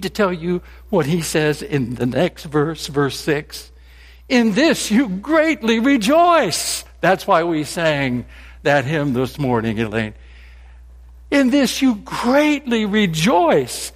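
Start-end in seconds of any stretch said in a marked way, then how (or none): 4.3-6.9 slow, high, ringing delivery of the verse; emphatic verse. Acted. Preacher proclaims the verse to the congregation, slow and ringing. 11.3-13.9 slow, high, ringing delivery of the verse; emphatic verse. Acted. Preacher proclaims the verse to the congregation, slow and ringing.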